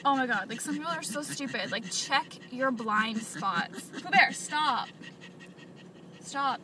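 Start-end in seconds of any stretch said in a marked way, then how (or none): 4.0-6.6 real pet scolding; scolding the dog. Real, not acted. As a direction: snap the dog out of it.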